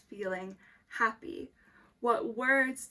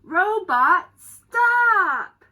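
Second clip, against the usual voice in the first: slow, loud, drawn-out title shout; title called out; 7 semitones higher, 17 dB louder, much slower than usual. Acted. Teacher shouting the book title at the class, slow and loud. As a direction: announce the title big to the class. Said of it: "reading the story".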